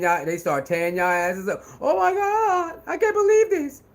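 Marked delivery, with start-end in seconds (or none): 1.8-3.8 mock crying. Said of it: "all they do is cry".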